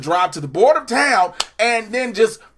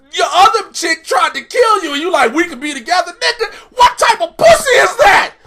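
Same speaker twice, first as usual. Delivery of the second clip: much higher, loud rant; incredulous yelling; 9 semitones higher, 5 dB louder than usual. Real, not acted. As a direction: yell it at the character. Ranting at the show.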